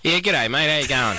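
hardcore Australian greeting; broad, hammed-up Australian accent; mock Australian accent. Shown as a demonstration. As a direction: lay the Australian accent on thick. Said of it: "this is our mock Australian accent".

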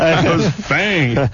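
slow, drawn-out gargoyle voice. Acted. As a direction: drag it out slowly like a gargoyle. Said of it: "the gargoyle voice".

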